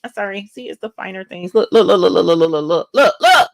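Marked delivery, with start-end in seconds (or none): 1.6-3.5 loud, rapid, thrilled repeats; excited look look. Real, not acted. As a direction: show off the bling gleefully. Showing off her new shirt.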